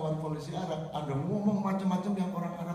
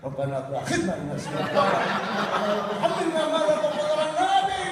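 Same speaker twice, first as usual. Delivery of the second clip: slow, louder drawn-out prayer voice; mock prayer; 4 semitones higher, 4 dB louder than usual. Pilgrim recites his prayer aloud before the Kaaba, slow and intoned. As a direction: intone the prayer slowly, then lift it high.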